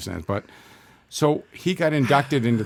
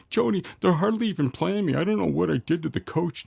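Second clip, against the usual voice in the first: high-pitched, whiny complaint; whining; 8 semitones higher than usual. Retold or imitated. Son imitates his tough hockey-player dad writing to Mom, whining. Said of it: "he's just whining".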